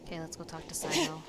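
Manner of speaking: usual speaking voice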